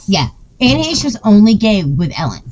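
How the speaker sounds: ordinary delivery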